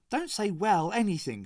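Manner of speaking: high, pointed telling-off; a mild scold between friends. Acted. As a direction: correct her friend sharply. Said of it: "Sharon scolded".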